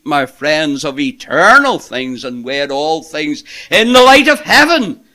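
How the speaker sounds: loud, high preaching, building to a ringing peak; impassioned preaching. Real, not acted. Preacher urging his friends toward the eternal perspective, impassioned. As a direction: preach with mounting force.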